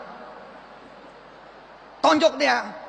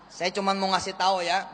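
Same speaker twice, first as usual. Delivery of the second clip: usual speaking voice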